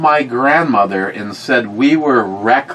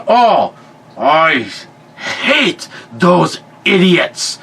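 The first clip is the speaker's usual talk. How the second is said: high, slow mock exasperation; exasperated grandfather; 4 semitones higher, much slower than usual. Grandfather venting about the complainers, imitated exasperation. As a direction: drag it out word by word, imitating fed-up scorn.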